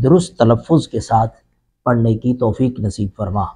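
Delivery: low, measured prayer-like opening; solemn religious opening to a lecture; formal invocation. Acted. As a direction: recite the blessing solemnly before class. Formal opening prayer before lesson.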